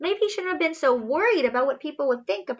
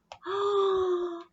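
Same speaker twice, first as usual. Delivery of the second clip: delighted gasp; 6 semitones higher, 6 dB quieter than usual. Acted. Reader gasps at first flight.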